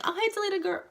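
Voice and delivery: put-on very high-pitched girly hello; chirpy greeting. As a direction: sing out a greeting to a friend, way up high. Greeting expected friend at door.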